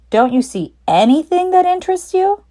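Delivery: a sly dad hinting at a surprise; Mister Fox. Acted. Mister Fox teasing children.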